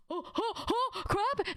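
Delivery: high, gasping mock alarm; retold panic. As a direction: act out spotting a body in the snow. Retold panic at seeing a body.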